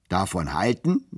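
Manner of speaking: usual speaking voice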